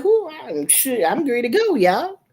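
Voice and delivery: fired up to defend her son; ready to fight. Retold or imitated. Mother ready to confront whoever hurt her son, high and heated. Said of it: "That's how I felt".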